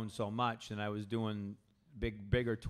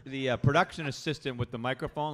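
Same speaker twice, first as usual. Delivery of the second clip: ordinary talk, nothing marked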